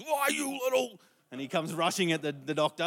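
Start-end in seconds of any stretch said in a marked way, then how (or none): 0.0-1.0 high, loud mock Homer yell; cartoon dad losing it; Homer's strangle yell. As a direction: snap like Homer lunging at the doctor. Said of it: "Homer does his things".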